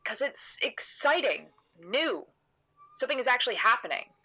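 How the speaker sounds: high, emphatic burst of enthusiasm; excited. Acted. Narrator trying to show her how exciting it is, excited. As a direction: sell the thrill, pitch leaping up. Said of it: "trying to show her how exciting it is".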